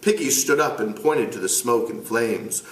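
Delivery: ordinary delivery, nothing marked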